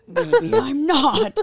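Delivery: slow, high, unsure reply; hesitant mock realization; doubtful self-questioning. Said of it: "he was so incredulous that I was like".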